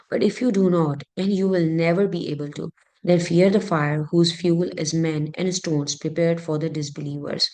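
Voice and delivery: normal talking voice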